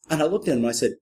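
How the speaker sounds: usual speaking voice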